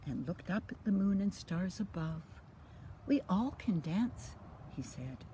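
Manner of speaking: lively storybook read-aloud; reading to children. A narrator's reading. Grandma reading a bedtime story, lively and sing-song. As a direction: bring the rhymes alive for little ones. Reads picture book to children.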